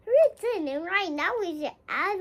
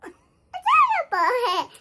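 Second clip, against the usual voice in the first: slow, loud giggly drawn-out word; laughing repeat; 8 semitones higher, 10 dB louder, slower than usual. Real, not acted. Toddler repeating mommy's word, laughing. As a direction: stretch it out giggling.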